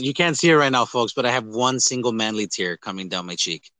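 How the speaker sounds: ordinary talk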